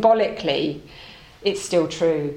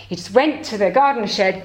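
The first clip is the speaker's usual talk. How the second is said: ordinary delivery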